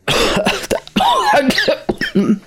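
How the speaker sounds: hohes, würgendes Husten; gequältes Husten. Acted. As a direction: ein Geschlagener hustet keuchend. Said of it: "sagt er". (hohes, gequältes Husten)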